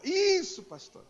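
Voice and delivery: overplayed, near-shouted yes; very eager yes. Pastor agrees again, more intense.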